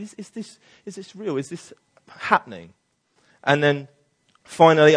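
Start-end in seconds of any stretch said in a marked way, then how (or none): 0.0-2.7 much softer doubtful questioning; doubting hush. Retold or imitated. Believer doubting whether God spoke, questioning softly. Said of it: "you start to doubt".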